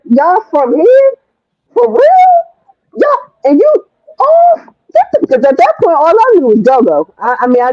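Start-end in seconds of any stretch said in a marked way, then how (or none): 0.1-4.8 slow then climbing far higher, astonished; can't believe such talent is local; amazed excitement. Retold or imitated. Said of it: "I'm like".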